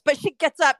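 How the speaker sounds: ordinary talk, nothing marked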